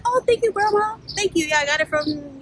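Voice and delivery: high, sweet replayed thanks; polite thanks. Granddaughter thanks grandma for a sweater compliment, high polite voice. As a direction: pitch it up politely, then let it sink.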